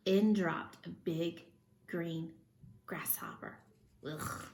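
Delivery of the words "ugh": disgusted ugh. Acted. Disgust at grasshopper.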